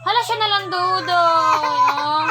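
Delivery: insistent shouted call. Real, not acted. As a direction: call it out loudly, stretching the ending. Calling out to Dudoy, insistent.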